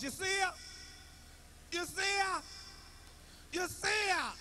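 high-pitched worried call from the porch; wife calling out. Retold or imitated.

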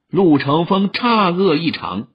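ordinary delivery, nothing marked

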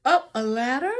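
ordinary delivery

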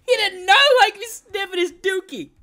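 high, loud laughter carried into the words; giggling high and loud after a fright; laughing. Real, not acted. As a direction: laugh through the sentence.

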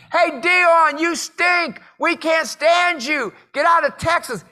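high, loud jeering yell; screaming heckler. Retold or imitated. Angry fan yelling at a rival player, screaming. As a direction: holler abuse at a player across the field. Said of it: "the guy screaming at him".

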